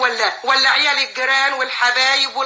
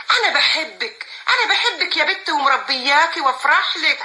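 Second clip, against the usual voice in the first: usual speaking voice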